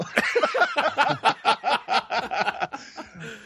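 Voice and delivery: high laugh after the accent; real laugh at himself. Real, not acted.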